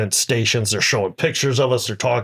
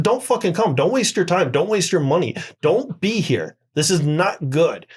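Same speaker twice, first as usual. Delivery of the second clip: high, raised, pleading yell at him; retold angry outburst; 7 semitones higher than usual. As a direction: fire it back at him, pitched way up. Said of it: "I do lose my cool on him".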